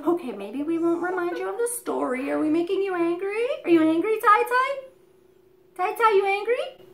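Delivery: high, sing-song questions to a pet; coaxing pet talk. Real, not acted. Owner asks her bird whether he is angry, high coaxing coo. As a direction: coo upward as if soothing a bird.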